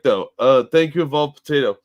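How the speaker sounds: normal talking voice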